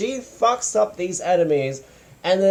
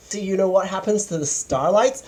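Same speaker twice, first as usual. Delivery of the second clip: usual speaking voice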